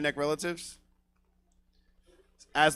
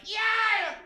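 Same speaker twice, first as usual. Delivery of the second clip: shrill high yelp of a tweaker bursting in; a hyped-up tweaker's high-pitched yell. Retold or imitated. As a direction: squeal it way up high like a wild customer. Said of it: "she comes in hell's blazing".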